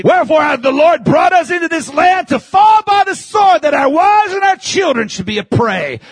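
accusing Israelites. Retold or imitated. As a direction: hurl the blame at God. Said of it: "watch what they say".

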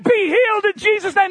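high loud bold command to be healed; fervent shouted healing command. Retold or imitated. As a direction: proclaim healing with full force.

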